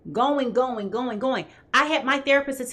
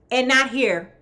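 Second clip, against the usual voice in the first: normal talking voice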